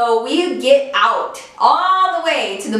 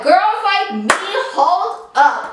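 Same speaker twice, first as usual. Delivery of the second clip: put-on higher, slow, offended "girls like me?"; indignant disbelief; 9 semitones higher, slower than usual. Girlfriend stunned by boyfriend's "girls like you", indignant. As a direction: stop him cold, stunned and offended.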